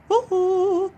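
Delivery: high, loud whoop; joyful woo-hoo; excited cheer. Real, not acted. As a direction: whoop with glee at the end. Cheer after song.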